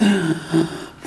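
high awed gasp; gasp of wonder. Retold or imitated. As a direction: gasp like an amazed kid. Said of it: "I was like".

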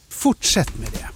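a breathy, confiding whisper; a hushed little aside. Acted. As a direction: tell him like a secret. Karlsson's aside to the boy.

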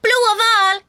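put-on high-pitched child's shout. A narrator's reading. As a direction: blurt it out in a high yell. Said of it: "skriker hon rakt ut". (högt, gällt utrop)

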